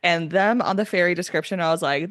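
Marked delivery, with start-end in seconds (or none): none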